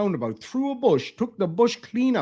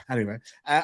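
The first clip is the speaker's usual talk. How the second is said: ordinary talk, nothing marked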